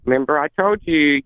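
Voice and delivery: usual speaking voice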